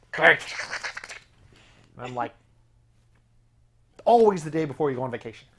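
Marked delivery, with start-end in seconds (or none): 0.1-0.4 loud, harsh mechanical clack; horrible door noise. Retold or imitated. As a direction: imitate a garage door jamming with a bang. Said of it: "made this horrible noise".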